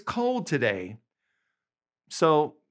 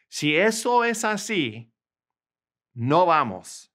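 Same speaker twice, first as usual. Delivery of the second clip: emphatic model; 4 semitones higher, 4 dB louder than usual. Shown as a demonstration. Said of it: "teacher models Spanish sentence".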